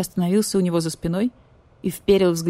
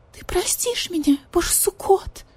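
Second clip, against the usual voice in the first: higher, whispered tearful plea; tearful purr; 12 semitones higher than usual. Retold or imitated. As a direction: purr it through tears. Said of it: "мурлыкнула маркиза сквозь слёзы".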